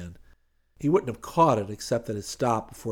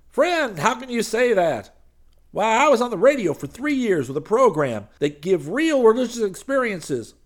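loud, high preacher voice; boasting radio preacher; 13 semitones higher, 8 dB louder than usual. Acted. Preacher boasting to Hayes of his show, loud and high. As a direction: sell yourself as a radio star.

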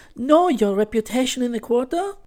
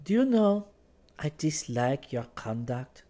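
higher woman's voice, composed; calm disapproval; 6 semitones higher, slower than usual. A narrator's reading. Valentine reproves Clifford at the table, calm. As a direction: state displeasure calmly and deliberately. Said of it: "she said calmly".

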